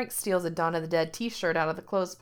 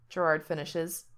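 ordinary delivery

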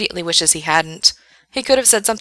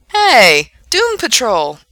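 loud, lifted greeting; excited calling out; 5 semitones higher, 7 dB louder, much slower than usual. Acted. Gerard spots a stranger's comic, calls out excitedly. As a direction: hail a fellow fan with delight.

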